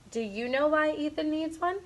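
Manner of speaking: ordinary delivery, nothing marked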